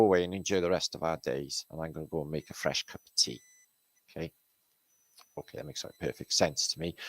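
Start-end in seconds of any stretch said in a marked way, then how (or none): none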